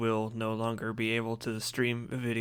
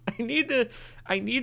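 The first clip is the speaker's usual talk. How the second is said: laughing; 11 semitones higher than usual. Real, not acted. Laughing at his own plan.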